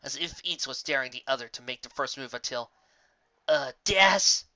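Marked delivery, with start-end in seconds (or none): 3.5-4.4 a touch higher, nervous little squeak; lightly voiced Griffin nudging her friend; Griffin squeaking nervously. A narrator's reading. Griffin nudging Dash, a nervous squeak. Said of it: "the Griffin squeaked".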